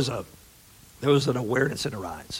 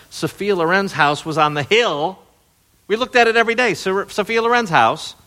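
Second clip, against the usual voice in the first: excited; 9 semitones higher, 6 dB louder than usual. Real, not acted. Excited about famous neighbor's house.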